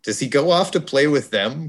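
ordinary delivery

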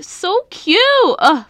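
high squeal of delight; excited gushing. Real, not acted. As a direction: gush over how cute it is. Gushing over cute video.

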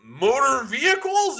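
slow, high puzzled question. Retold or imitated. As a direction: act confused, drawn out. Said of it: "He's like".

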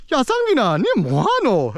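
high, swooping Busan dialect; Busan uncle impression. Uncle calling out to nephew, high sing-song Busan accent. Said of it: "My Busan uncle is like".

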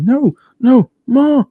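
put-on high, loud childlike wail for mama; mock cry for mama. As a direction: call out for your mother in terror. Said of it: "he's here like".

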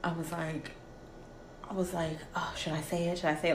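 very soft, anxious inner voice; softly fretting over saying love; nervous whisper to herself. Retold or imitated. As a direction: hush it as if he might hear. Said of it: "this is me".

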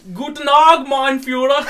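hammed-up German cyclist hailing; German greeting. Cyclist greets Hitler in the Simpsons, mock German. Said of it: "and he's like".

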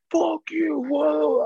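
high, mocking heckler yell; mock drunk heckler. Fan heckling a player, mock high jeer. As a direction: jeer like a loudmouth fan in the stands. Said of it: "asshole fans that are just like".

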